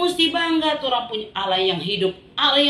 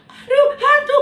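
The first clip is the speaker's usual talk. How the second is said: terrified shriek; 9 dB louder than usual. Acted. Disciples seeing a ghost.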